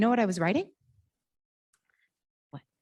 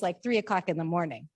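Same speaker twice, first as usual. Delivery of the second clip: ordinary talk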